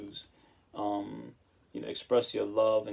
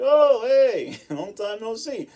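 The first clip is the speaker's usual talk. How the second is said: much higher and louder, over-bright hello; mock cheerful greeting; 11 semitones higher, 10 dB louder, slower than usual. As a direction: act thrilled to bump into her. Greeting his ex after years.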